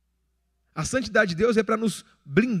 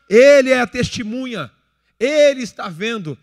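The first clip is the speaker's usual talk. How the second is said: raised, louder preaching voice, driving each point home; preaching at full force; 5 semitones higher, 5 dB louder than usual. Acted. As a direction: preach it hard to the congregation. Pregação em tom elevado. (pregação inflamada, voz alta)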